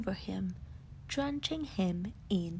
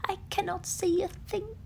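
high, louder hopeful bear voice; a blinded bear hearing a friend; relieved Pooh; 9 semitones higher, 4 dB louder than usual. Acted. Pooh answers Rabbit, relieved but unable to see. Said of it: "said the leafy covered monster, Pooh".

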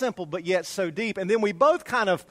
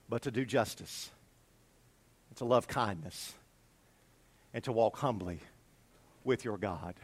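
low, soft, measured verse with long pauses; solemn scripture recitation; 6 semitones lower, 7 dB quieter than usual. Acted. A voice in the courtroom answering mortals, solemn and quiet.

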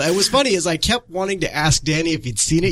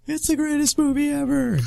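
gushing fan; 11 semitones higher than usual. Retold or imitated. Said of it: "that were like".

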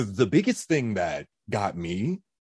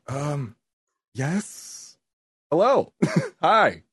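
content creator mode; 6 semitones higher, 4 dB louder, much slower than usual. Retold or imitated. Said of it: "me as a content creator mode".

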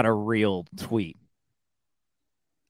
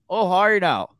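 put-on Letterkenny accent; 7 semitones higher, faster than usual. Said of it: "this show Letterkenny".

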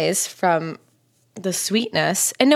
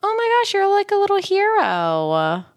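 gushing; 12 semitones higher than usual. Real, not acted. Praising a friend who helped a girl, high gushing coo.

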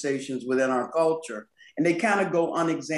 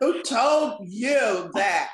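very slow, higher, sassy challenge; hammed-up sass; 6 semitones higher, 3 dB louder, much slower than usual. Shown as a demonstration. Guest hamming up her book's title for laughs, very sassy. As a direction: stretch "told" and "you" right out with big sass. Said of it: "that little sassy".

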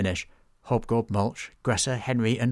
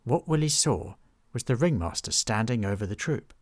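ordinary delivery

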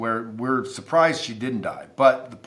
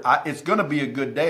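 ordinary delivery

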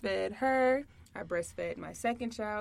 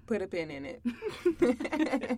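ordinary talk, nothing marked

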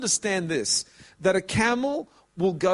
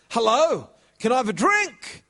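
asking servant; 5 semitones higher, 4 dB louder than usual. Acted.

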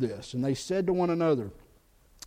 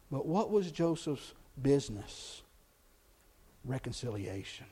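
much softer and lower, letting the key word land; quiet dramatic answer; hushed reveal; 5 semitones lower, 9 dB quieter than usual. Real, not acted. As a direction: drop it to almost nothing for the punchline. Preacher drops for the reveal.

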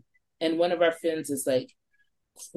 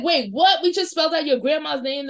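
stunned disbelief; 8 semitones higher than usual. Retold or imitated. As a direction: blurt it out, thrown off guard. Friends stunned by the board.

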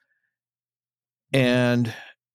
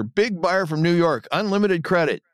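mock holler, pitched up; imitated hollering; 8 semitones higher than usual. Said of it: "hollering at no one in particular".

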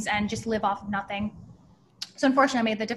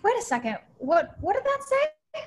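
double take; 11 semitones higher than usual. Retold or imitated. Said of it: "I was like".

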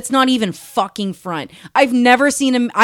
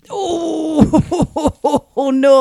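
shocked laughing cry; 5 semitones higher than usual. Real, not acted.

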